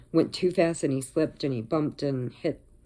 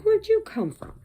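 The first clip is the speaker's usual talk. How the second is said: much higher surprised voice; startled by a sudden stray cat; high-pitched where'd you come from; surprised question; 4 dB louder than usual. Retold or imitated. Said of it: "I'm like".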